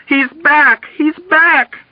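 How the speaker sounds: very high repeated shriek; hysterical scream. A narrator's reading. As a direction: keep screaming the alarm. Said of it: "Nurse Kramer screamed".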